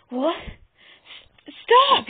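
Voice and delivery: startled, stammering into a loud high bark. A narrator's reading. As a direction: choke out the start, then bark the last word. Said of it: "he barked".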